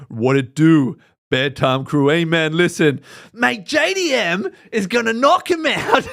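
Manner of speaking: put-on a light Aussie accent hyping the fight; Bedtime's Aussie impression. Imitating Bedtime's Aussie voice to his crew. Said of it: "he switches up so well".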